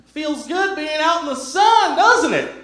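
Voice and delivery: put-on high sweet old lady; chirpy little old lady greeting. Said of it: "She's just like".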